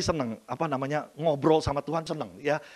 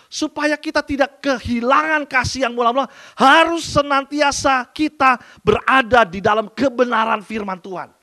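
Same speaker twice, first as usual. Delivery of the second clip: louder, higher fervent exhortation; preaching; 6 semitones higher, 7 dB louder than usual. Acted. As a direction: preach it out to the congregation. Preaching at full force. (khotbah berapi-api dengan suara keras)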